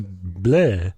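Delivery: slow lightly voiced Dracula; a hint of cliché Dracula, drawn out. Shown as a demonstration. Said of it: "the cliche, like, Dracula voice".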